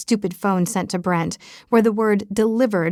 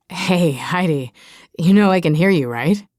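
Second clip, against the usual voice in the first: a hint of a lower man's voice, amused; lightly voiced amused Brent; 3 semitones lower than usual. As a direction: tease her through the door in a slightly lower voice. Brent speaks through the door.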